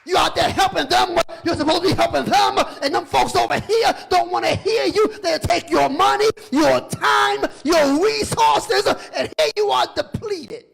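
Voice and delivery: loud, high preacher's exhortation; preaching at full force. Real, not acted. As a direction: hammer the congregation with it.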